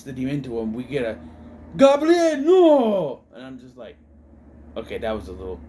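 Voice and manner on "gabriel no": loud, high anguished movie shout; dramatic cry. Retold or imitated. Acting out the film's cry during Father Mort's possession, dramatic shout. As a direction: cry it out, long drawn-out "no".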